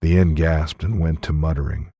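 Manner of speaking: ordinary delivery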